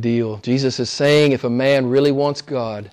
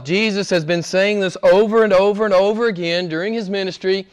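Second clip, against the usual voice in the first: normal talking voice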